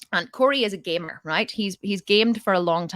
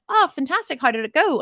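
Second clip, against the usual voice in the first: high, eager interest; retold delight; 7 semitones higher, 4 dB louder than usual. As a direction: react keenly, pitch jumping up on the praise. Retold delight at son's news.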